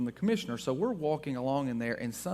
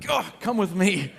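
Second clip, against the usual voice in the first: raised, louder retold order with a grunt; lightly acted urgency, hauling someone away; mock urgent command; 5 semitones higher, 7 dB louder than usual. Husband grabs his wife by the arm as police come, mock urgent.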